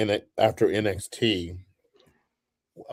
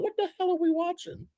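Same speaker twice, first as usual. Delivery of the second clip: high, hushed disbelief; incredulous; 6 dB quieter than usual. Retold or imitated. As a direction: squeak it in shocked confusion. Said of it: "looked at each other like".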